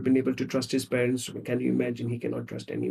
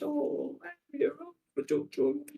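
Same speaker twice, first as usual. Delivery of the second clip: high, soft cooing; hammed-up gentle comfort, small high voice; tender soothing; 13 semitones higher, 5 dB quieter, slower than usual. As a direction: soothe a partner as if cuddling. Acted soothing with physical touch.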